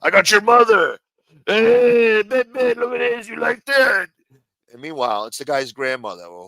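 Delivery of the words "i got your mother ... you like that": high, loud taunting impression of the doxer; mocking taunt. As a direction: gloat at them in a mock voice. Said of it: "And now you're doing it again".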